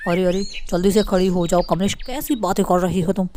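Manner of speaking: deep-voiced husband calming his wife; Raman's voice. A narrator's reading. Raman voiced, low male voice. (पति की भारी आवाज़)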